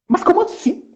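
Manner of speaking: high, startled question; surprised narrator. Acted. Second narrator reacting to the nonsense word, surprised. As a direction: gasp in disbelief. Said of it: "outro narrador surpreso".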